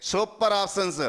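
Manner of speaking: ordinary talk, nothing marked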